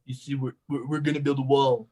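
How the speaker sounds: a light, loose take on Donald Trump; lightly voiced Trump bragging about a wall; Donald Trump impression. Friend asked for a Trump impression, so a light Trump voice. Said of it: "do a Donald Trump impression".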